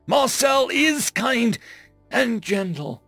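"is" high and drawn out; slow, high emphasis on "is", then "gentle"; emphatic insistence. A narrator's reading. Alien insists on friend's nature, stressing "is".

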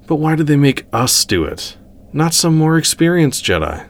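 high-pitched complaint; complaining. A narrator's reading. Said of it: "Tara complained".

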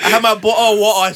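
hammed-up British accent with dropped t's; mock British accent. Guest repeating his bottle joke, mock British accent. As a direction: lean into the British vowels for a joke.

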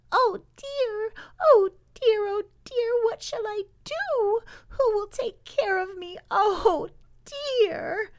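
put-on tiny, very high baby-animal wail; gentle little voice. Lost baby beaver calling from the woods, gentle crying voice. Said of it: "heard a gentle little voice".

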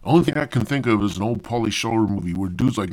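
usual speaking voice

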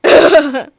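loud cheeky monster laugh. Acted. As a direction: laugh out gleefully. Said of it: "The monster said".

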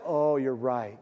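deflated, caught in the act; low, quiet sheepish concession; sheepish admission. Retold or imitated. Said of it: "you're like".

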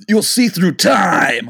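slow, far-off oracle voice; mock prophetic announcer; mystical slogan voice. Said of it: "the can's slogan, mystical".

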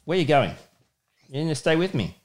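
ordinary talk

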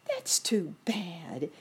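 slow whispered ant voice; sympathetic ant. A narrator's reading. Ant with glasses pities the hungry rabbit, slow whisper. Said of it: "exclaimed the ant who wore glasses".

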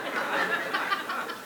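a short, soft laugh; quiet laugh. Real, not acted. Preacher laughing about his daughter's question, quiet laugh. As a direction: let a small laugh slip out.

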